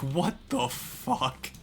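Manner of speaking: slow, drawn-out stunned what the fuck; stunned disbelief. Pretend. Imagining finding out the poster is a friend, stunned. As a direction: let the disbelief hang on each word. Finding out it's someone known.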